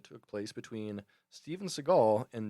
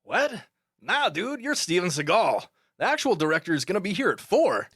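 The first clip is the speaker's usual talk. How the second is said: higher, louder, brash New York street voice; hyped, loud dude voice, pitched up; John Leguizamo impression; 6 semitones higher, 5 dB louder than usual. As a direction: scoff at the director like a cocky young actor. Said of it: "John Leguizamo kinda talks like this".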